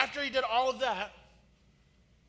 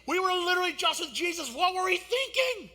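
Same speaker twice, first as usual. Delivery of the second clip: higher, louder, frantic mock panic; pleading in a slowed-down frantic voice; panicked disciples; 8 semitones higher, 5 dB louder than usual. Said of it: "they're in that moment going".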